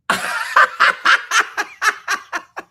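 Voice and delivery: high, loud cackling laugh; helpless high-pitched laughing; hard laugh. Real, not acted. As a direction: burst into a long run of laughter. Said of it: "I laughed so hard".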